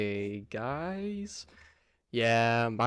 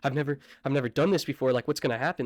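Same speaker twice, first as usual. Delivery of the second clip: fast, louder feigned panic; mock freak-out; 3 semitones higher, 5 dB louder, faster than usual. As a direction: act like you're freaking out a little. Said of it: "I start freaking out a little bit".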